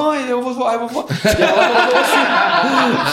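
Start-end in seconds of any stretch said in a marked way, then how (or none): 0.0-1.1 high-pitched mock-panicked kid calling out; imitating alarmed child. Child tells mother he fears flying away, mock alarm. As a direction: act out a worried child calling mum.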